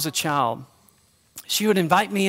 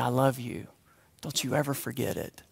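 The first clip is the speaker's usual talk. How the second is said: much softer, lower, hushed; tender whisper; 7 semitones lower, 11 dB quieter than usual. Retold or imitated. Grandmother whispering to grandson beside him, tender and hushed. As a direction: whisper it close to the ear. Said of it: "whispering in my ear".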